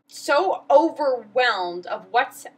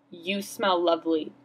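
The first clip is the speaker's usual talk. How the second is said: dead serious; 7 semitones lower than usual. Retold or imitated. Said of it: "in the most dead serious way".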